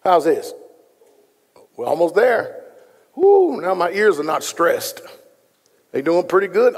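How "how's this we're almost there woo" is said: ordinary talk, nothing marked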